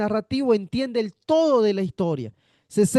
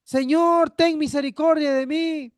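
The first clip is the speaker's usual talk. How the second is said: higher, pleading call to the Lord; a blind man crying out for mercy, raised pitch; mock cry for mercy; 5 semitones higher than usual. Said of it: "él grita y dice".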